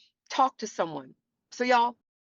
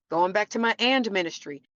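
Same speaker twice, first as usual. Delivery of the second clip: ordinary talk, nothing marked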